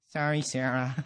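low, soft, sheepish sorry; acted meek, crestfallen boy; deflated apology. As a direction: apologise with drooped shoulders. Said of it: "His shoulders drooped".

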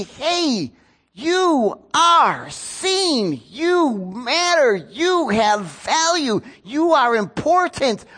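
high, slow, drawn-out insistence; pleading encouragement, every word stretched; impassioned affirmation. Acted. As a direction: press each word home to someone who feels worthless. Imagined rescuer affirming a hurting girl.

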